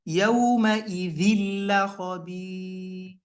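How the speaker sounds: يَوْمَئِذٍ لَخَبِيرٌ with the tanween cut and merged into lam; recited idgham. Shown as a demonstration. Teacher reciting to show tanween merged into lam. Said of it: "we had to insert it with the lam".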